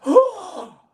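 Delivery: high, loud gasp, then slow denial; hammed-up indignant little bunny; shocked gasp. A narrator's reading. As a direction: gasp as if scandalised, then deny it slowly. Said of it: "And Betty gasps".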